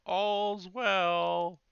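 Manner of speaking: high, loud sentry's call; yelling out. Acted. Sentry behind the party yelling out the all-clear, calling loudly. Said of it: "as he's yelling out".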